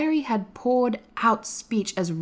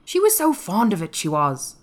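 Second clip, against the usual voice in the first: slow reminiscence, higher then settling; 4 semitones higher than usual. A narrator's reading. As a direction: say it slowly, remembering. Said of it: "said Ben Weatherstaff slowly".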